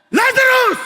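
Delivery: a loud, high call of "Lazarus!"; shout. Retold or imitated. Jesus calling Lazarus from the grave, shouting. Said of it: "Sumigaw eh".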